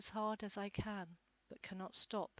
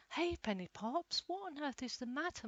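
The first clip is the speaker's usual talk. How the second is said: high kind lady; 8 semitones higher than usual. A narrator's reading. Lady asks dog kindly.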